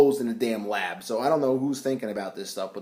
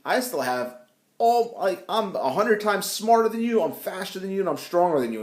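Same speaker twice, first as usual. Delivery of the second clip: 1 gloating taunt; 6 semitones higher than usual. Acted. Villain taunting the Hulk.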